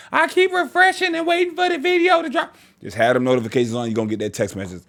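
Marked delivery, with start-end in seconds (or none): 0.1-2.5 high mocking whine of an impatient viewer. Vlogger mocking fans who beg for uploads, high mock whine. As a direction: squeak it like a whiny fan. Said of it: "I'm tired of hearing".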